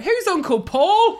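high, loud, drawn-out question; hammed-up puzzled outburst; baffled auntie. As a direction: imitate a bewildered auntie's shriek. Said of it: "And my auntie went".

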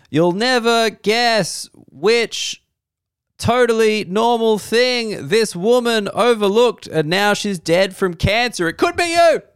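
high, slow, breathless tabloid teaser; clickbait headline voice. Acted. Comedian mocking wife's tabloid, hammed-up clickbait voice. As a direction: sell the scare like a sleazy headline.

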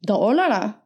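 lilting Dalarna dialect; Dalarna accent. Acted. First try at dalmål, Dalarna accent. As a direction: imitate someone from Dalarna. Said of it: "Dalmål".

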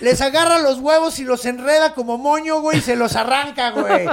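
high, loud put-on voice of a swaggering brawler, laughing through it; cocky Enkidu voice. Enkidu taunting the forest monster, high loud mocking brag. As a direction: brag and taunt like a cartoon tough guy. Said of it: "Y pinche Enkidu".

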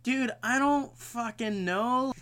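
high, drawn-out protest; mock exasperation. Retelling the guy woken up on the couch, mock exasperation. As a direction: act put-upon and clueless. Acts the woken, baffled housemate.

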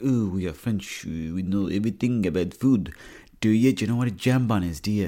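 lightly put-on French snob accent, proud of the food; mock French accent. Mocking a French know-all about food in a French accent. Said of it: "the way the French go on about how good they are".

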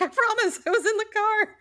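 high, loud insistent protest; pleading protest. Retold or imitated. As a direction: swear you were really there. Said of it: "So I'm like".

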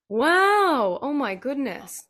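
real astonishment breaking out on the wow; shocked gasp. Real, not acted. Shocked at diagnosis age nineteen.

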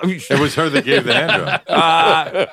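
laughing jab. Real, not acted. Friend teases the man about his ex-wife, laughing. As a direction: toss in the joke while laughing.

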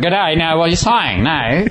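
slow, high, hammed-up Aussie drawl; mock Australian accent. Mimicking offended Australians in a mock Australian accent. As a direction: lay on the Aussie G'day, slowly.

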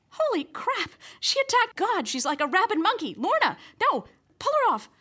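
high frantic screaming; panicked shouting. Acted. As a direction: shriek at the brawl in panic. Reporter panics at fight.

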